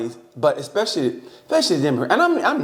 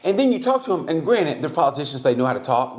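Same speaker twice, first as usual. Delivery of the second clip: ordinary delivery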